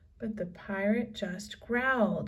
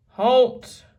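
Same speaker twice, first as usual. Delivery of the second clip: very loud halt; command shout; 3 semitones higher, 13 dB louder than usual. A narrator's reading. Captain stopping the escaping kids, loud halt. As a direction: stop them dead. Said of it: "Captain Bones shouted".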